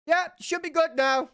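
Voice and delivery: high-pitched little gnome voice; high, hurried gnome; gnome character. Acted. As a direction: pipe up high as a gnome urging them through. Gnome NPC hurries the party through.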